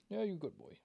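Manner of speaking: high doggy voice. Real, not acted. Owner cooing high-pitched praise at Buster.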